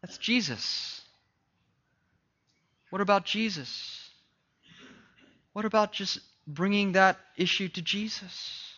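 hushed sermon voice; soft preaching. Acted. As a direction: draw them in gently.

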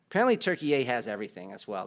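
normal talking voice

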